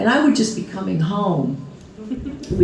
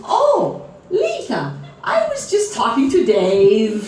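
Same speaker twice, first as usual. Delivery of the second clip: mother's voice; 6 semitones higher than usual. Retold or imitated. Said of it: "She says".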